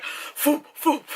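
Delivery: whooshing foop sound. Retold or imitated. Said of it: "to actually, like".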